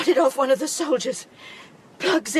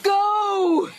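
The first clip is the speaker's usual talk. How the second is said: desperate tearful shout; 6 semitones higher, 4 dB louder than usual. Acted. Dying Beth sends daughter away.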